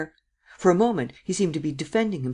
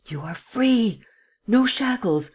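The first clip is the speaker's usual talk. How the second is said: high, ringing eagerness; urgent, elated exhortation; eager; 3 semitones higher, 3 dB louder than usual. A narrator's reading. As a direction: proclaim it eagerly, like announcing a liberation. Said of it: "he continued eagerly".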